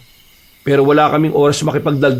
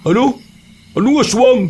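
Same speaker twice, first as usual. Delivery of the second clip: high-pitched startled disbelief; taken-aback, higher-pitched questioning; incredulous; 10 semitones higher than usual. Acted. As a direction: question it as if you misheard. Reacting to claim of aswang.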